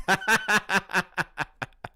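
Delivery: high burst of hearty laughter; real laugh. Real, not acted. Amused by the joke about his core strength, real laugh. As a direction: laugh out loud in a long, high peal.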